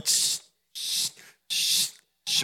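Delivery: soft unvoiced shushing; shushing hiss. Preacher imitating two-faced people shushing, soft unvoiced hiss. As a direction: hush them with a breathy shh. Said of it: "And they go".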